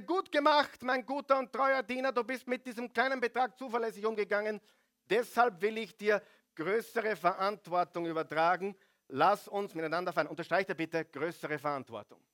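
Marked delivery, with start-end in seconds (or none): 0.0-10.3 delighted master. Acted. Master praises the servant.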